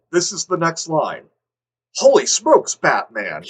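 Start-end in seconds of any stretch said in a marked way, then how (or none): 2.0-3.4 mock amazement. Reacting to the absurd next line, hammed-up amazement. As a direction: exclaim like a campy sidekick.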